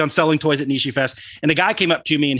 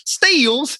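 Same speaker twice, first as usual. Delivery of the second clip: "Steals" stretched long in a Texan drawl, pitched up; exaggerated Texan accent; 10 semitones higher than usual. Shown as a demonstration. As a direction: ham up the Texan vowel in "Steals". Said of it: "my Texan accent came out".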